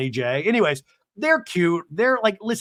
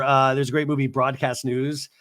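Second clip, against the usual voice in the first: usual speaking voice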